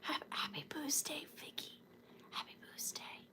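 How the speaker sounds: apologetic whispered greeting; soft unvoiced whisper. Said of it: "I'm sorry".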